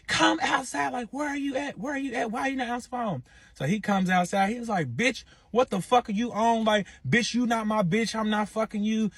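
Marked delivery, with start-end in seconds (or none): 0.1-3.2 imitating a woman calling through a door, high-pitched; high, insistent retelling of her demands; mock angry demand. Baby mama beating on the Airbnb door, mock angry high demand. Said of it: "She's beating on the door like". 4.9-9.1 high-pitched, indignant imitation of him; mock angry retort. Man coming outside to baby mama, mock angry high retort. Acted quarrel outside the Airbnb.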